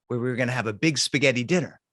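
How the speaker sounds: plain reading voice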